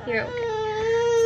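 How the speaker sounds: high, slow cooing reassurance. Real, not acted. Mom reassuring her toddler, slow high coo.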